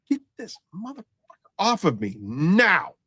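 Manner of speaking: high, strained order, slowing onto the last word; retold urgent demand. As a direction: act out wanting someone pulled off you. Retold demand to state trooper.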